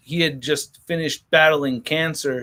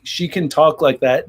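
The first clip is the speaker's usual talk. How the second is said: ordinary talk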